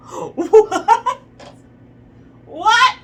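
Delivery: high, very loud disbelieving "what"; incredulous shriek. Real, not acted. Reader reacts to an absurd proposition in the story, incredulous shriek.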